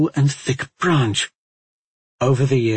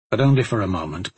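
plain reading voice

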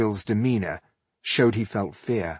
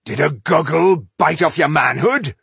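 loud, high mocking dwarf voice; gruff dwarf taunt; 7 semitones higher, 8 dB louder than usual. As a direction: bellow the insult like a brawler. Dwarf hurling an insult.

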